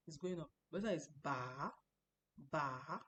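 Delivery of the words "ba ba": ba dipping down then up; third tone ba. Shown as a demonstration. Teacher showing students the dipping third tone. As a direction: let ba fall and hop back up. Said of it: "There's the ah, mm".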